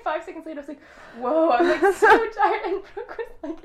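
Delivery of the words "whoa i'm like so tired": high, loud, laughing exclamation; retold amazement. Friend retells her first gummy hitting, hammed-up amazement. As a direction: play up the sudden wooziness, loud and amused.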